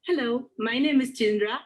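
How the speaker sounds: ordinary delivery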